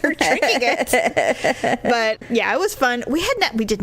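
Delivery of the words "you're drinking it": dry teasing. Retold or imitated. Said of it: "I'm like".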